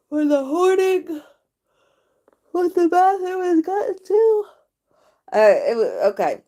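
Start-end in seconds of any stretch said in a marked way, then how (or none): 0.1-4.4 higher, strained, wincing; high nervous. Real, not acted. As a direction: brace yourself for the reveal. Nervous reveal of messy bathroom.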